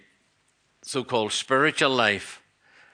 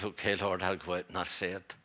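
quiet surrender; 3 semitones lower, 8 dB quieter than usual. Retold or imitated.

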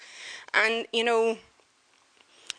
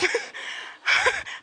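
laugh. Real, not acted. Friend's camel runs off.

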